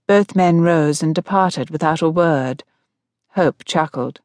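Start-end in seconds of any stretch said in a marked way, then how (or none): none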